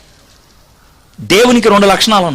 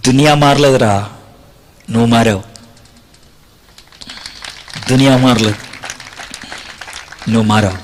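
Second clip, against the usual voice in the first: tender words; 9 semitones lower, 4 dB quieter than usual. Retold or imitated.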